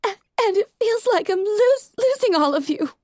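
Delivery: crying, barely holding on; choked up. A narrator's reading. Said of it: "Rarity choked up".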